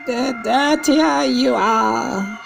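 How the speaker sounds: put-on high, slow old grandmother voice; grandmother's voice. Grandmother warning the boy about witches, high and slow. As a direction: lift into the grandmother's careful warning. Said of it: "she had said".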